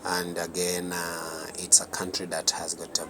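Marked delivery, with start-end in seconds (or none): none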